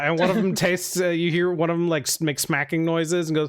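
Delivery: usual speaking voice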